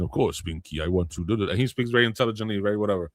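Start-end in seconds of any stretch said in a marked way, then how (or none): 0.0-1.5 a lower, lightly voiced imitation of Brain; the Brain impression. Host imitates the Brain cartoon mouse for co-hosts, lightly lower voice. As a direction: lightly put on Brain's haughty genius voice. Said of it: "he talks like this".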